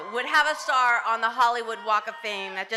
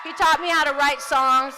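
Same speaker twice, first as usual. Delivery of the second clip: emphatic; 6 semitones higher, 10 dB louder than usual. Real, not acted. Emphatic tribute to her brother.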